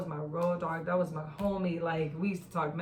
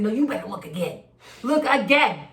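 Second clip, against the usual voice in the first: insistent denial; 3 semitones higher, 8 dB louder than usual. Retold or imitated. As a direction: refuse to believe it, demanding. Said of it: "I said".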